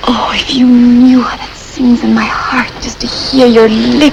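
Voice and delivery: slow, hammed-up lover's whisper. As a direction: breathe it out, swooning. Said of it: "I had you fooled for a minute".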